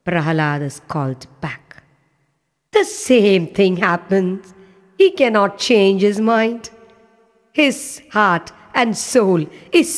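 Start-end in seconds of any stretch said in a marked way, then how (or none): none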